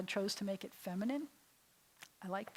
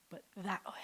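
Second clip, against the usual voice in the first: whisper; 7 dB quieter than usual. Shown as a demonstration. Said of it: "this way".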